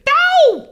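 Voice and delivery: loud, very high "ow"; sharp falsetto pop-star yelp. Acted. Punctuating the birthday news, high yelp. [name] yelp after his name.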